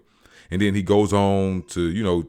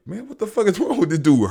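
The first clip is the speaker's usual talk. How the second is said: incredulous; 14 semitones higher, 6 dB louder, faster than usual. Retold or imitated. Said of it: "Kevin Hart and them like".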